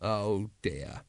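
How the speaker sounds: low, soft sigh of dismay; resigned, quietly deflated. Acted. Vimes hears Nobby's plan, quietly dismayed.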